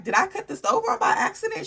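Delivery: laughing question. Retold or imitated. Retold worried question, laughing.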